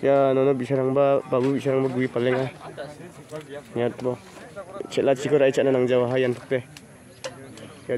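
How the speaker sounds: normal talking voice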